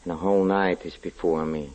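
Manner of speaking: soft, low, trembling with fear; hushed dread. Acted. A man alone at night, frightened, hushed dread. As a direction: whisper into the dark, dreading what's there.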